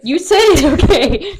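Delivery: laughing. Real, not acted. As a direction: laugh through it. Laughing reply.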